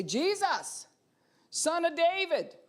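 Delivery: high, loud cry to Jesus. Shown as a demonstration. Preacher demonstrates crying out to Jesus. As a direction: call out in public desperation. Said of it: "would you be willing to say".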